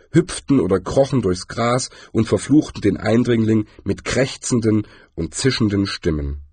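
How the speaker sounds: plain reading voice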